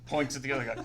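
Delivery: ordinary talk, nothing marked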